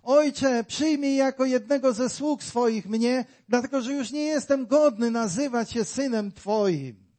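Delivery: pleading prodigal son. Acted. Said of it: "marnotrawny syn powiedział do swojego ojca".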